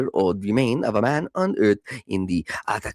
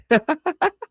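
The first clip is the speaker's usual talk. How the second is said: high laugh. Real, not acted. Laughs at own accent.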